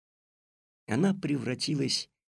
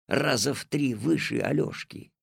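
plain reading voice

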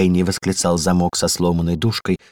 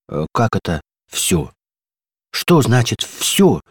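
slow, alarmed question; understated dismay; horrified; 3 semitones higher, slower than usual. A narrator's reading. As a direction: recoil in mild horror. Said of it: "ужаснулся замок".

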